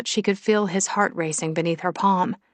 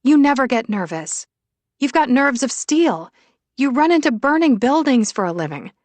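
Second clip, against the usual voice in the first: higher, louder woman's voice in protest; incredulous Willow; 6 semitones higher, 5 dB louder than usual. Acted. Willow disputes Shane's claim to nerves, incredulous. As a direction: insist on it, pitch riding high.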